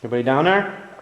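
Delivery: loud call down the hall. Real, not acted. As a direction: shout down the hallway to check. Calling down the hallway.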